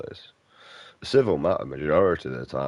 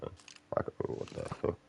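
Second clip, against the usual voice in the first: muttered curse; 12 dB quieter than usual. Real, not acted. Gaming frustration muttered.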